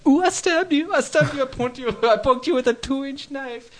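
very high, prissy squeak; mock timid stabber. Mocking a killer who only pokes, in a very high squeak. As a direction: squeak it like a dainty little stabber.

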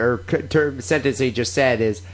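normal talking voice

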